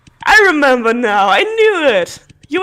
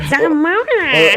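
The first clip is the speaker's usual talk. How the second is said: changed character voice; much slower than usual. Acted. Reader trying a new voice for Zalmona, drawn out. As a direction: stretch the name out in a new voice. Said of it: "I need to change her voice".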